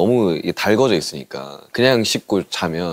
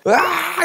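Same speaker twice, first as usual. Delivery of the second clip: re-enacted fan scream; much slower than usual. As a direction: squeal like a fan watching a decisive match. Re-enacts cheering while watching with friends.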